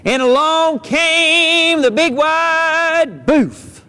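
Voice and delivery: slow suspenseful narration; ominous storyteller. A narrator's reading. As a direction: stretch it out like a spooky tale.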